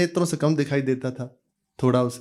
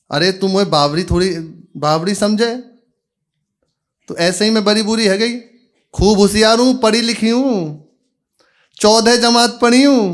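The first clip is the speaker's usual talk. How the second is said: proud boasting mother; 6 semitones higher, 4 dB louder than usual. Acted. Mother boasts she is clever.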